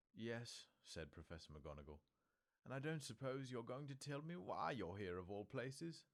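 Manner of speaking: wry, pressing for an answer; McGonagall, probing. A narrator's reading.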